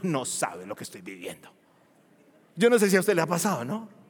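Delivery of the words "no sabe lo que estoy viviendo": much lower, much softer inner voice; inner thought. Retold or imitated. Preacher voicing his silent inner reply, muttered low. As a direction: mutter it under your breath, as if only to yourself. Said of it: "nomás por dentro digo".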